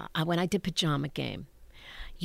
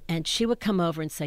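normal talking voice

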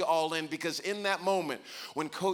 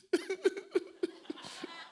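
mock child's sob; 4 dB quieter than usual. Child crying on the floor.

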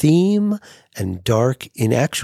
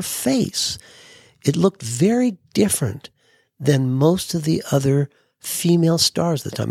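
put-on higher, thoughtful older host voice; imitating Hef; 5 semitones higher than usual. Hef explaining Gloria Grahame's career to Larry, in a higher thoughtful voice. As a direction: explain like a connoisseur.

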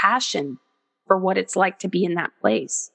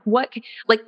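ordinary delivery

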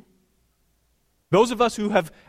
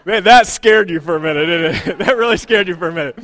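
laughing tease; 9 dB louder than usual. Real, not acted. Teasing the congregation after joke.

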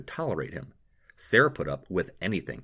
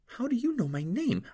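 a hint of a much higher suspicious girl; lightly voiced girl. A narrator's reading. Jade questioning the doctor, lightly voiced and wary. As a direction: sound wary of the stranger.

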